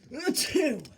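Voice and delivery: a big loud sneeze. A narrator's reading. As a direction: burst out in a loud fake sneeze. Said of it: "Thomas let out a loud sneeze".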